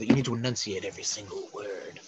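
low, soft, over-enunciated impression; James Spader. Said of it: "That's a good James Spader, uh, impression".

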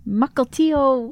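"Mukilteo" said slowly as MUCK-il-TEE-oh; careful local pronunciation. Shown as a demonstration. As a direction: stretch out "Mukilteo", MUCK like buckle. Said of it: "how I learned how to say that".

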